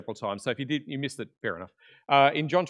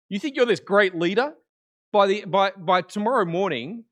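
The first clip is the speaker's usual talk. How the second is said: raised, louder mock-incredulous challenge; challenging; 6 semitones higher, 6 dB louder than usual. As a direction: puncture his boast, pressing hard. Acting Jesus challenging Peter's boast.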